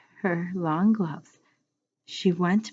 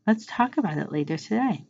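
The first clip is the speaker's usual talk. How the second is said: plain reading voice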